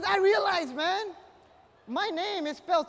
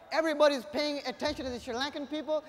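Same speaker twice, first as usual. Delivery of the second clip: ordinary talk, nothing marked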